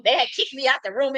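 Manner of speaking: usual speaking voice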